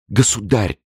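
pleading. A narrator's reading. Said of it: "молила она".

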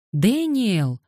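put-on high little-girl call, falling away; small girl hailing someone. A narrator's reading. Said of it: "окликнула она".

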